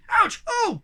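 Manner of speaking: startled gasp. Acted. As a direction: jump out of your skin. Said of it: "gasped the Hemulin".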